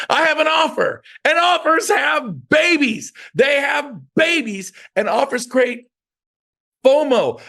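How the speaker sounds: shouting that offers have babies; emphatic shout. Real, not acted. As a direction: belt it out like a fired-up coach. Hammering home the sales point.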